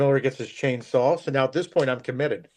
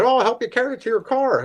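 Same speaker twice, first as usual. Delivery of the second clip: high friendly voice; 9 semitones higher, 3 dB louder, faster than usual. Retold or imitated. Mr. Miller voiced in story.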